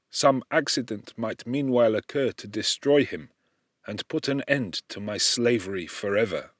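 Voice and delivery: plain reading voice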